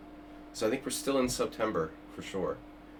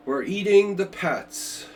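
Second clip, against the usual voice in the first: mock Trump line; 7 semitones higher, 5 dB louder, slower than usual. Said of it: "Trump's accent".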